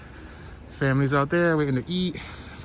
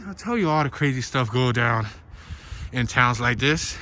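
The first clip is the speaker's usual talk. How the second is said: ordinary delivery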